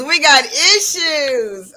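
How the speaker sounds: slow, loud, high stretch of each word; drawn-out show name. Real, not acted. Host proudly repeating her show's name to listeners, slow and loud. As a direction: savour the show's name like a slogan.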